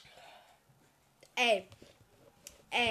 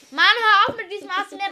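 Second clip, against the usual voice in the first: loud; 8 semitones higher, 12 dB louder than usual. Real, not acted. Scolding friend, loud.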